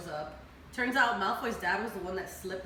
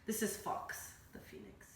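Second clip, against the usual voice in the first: soft whisper, like presenting a treasure; whispered introduction; 11 dB quieter than usual. Real, not acted. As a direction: breathe it out as a hushed reveal. Introducing the phoenix tenderly.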